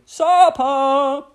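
mum's loud, high call to the table; high, loud summons. A narrator's reading. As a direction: holler it out like a dinner call. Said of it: "heard his mother call".

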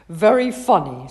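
loud dry sarcasm; sarcastic. A narrator's reading. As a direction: brush it off drily. Mum dismissive.

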